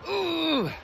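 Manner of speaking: high, tight "ooh" of tension; high-pitched clenched groan, acted out; tense strained ooh. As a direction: imitate seizing up with a high, soft ooh. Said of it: "Like, tense".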